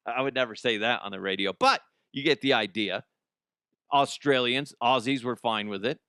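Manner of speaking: usual speaking voice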